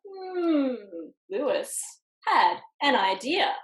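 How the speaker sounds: usual speaking voice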